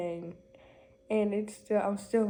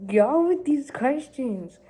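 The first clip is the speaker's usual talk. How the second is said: exasperated; 5 semitones higher, 10 dB louder, slower than usual. Real, not acted. Exasperated at the questions.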